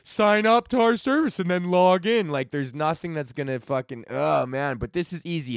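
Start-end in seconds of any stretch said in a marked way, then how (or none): 0.0-2.3 a hint of a corporate ad voice; mock website sign-up pitch. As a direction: parody a pushy website asking you to sign up. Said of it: "it's just like".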